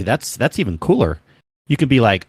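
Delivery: normal talking voice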